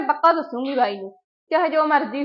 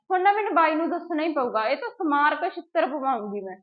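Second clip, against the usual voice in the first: ordinary delivery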